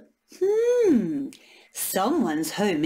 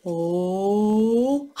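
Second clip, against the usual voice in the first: ordinary talk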